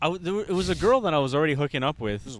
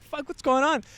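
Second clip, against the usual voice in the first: alarmed reaction; 10 semitones higher than usual. Retold or imitated. Acts alarmed reaction to the racket.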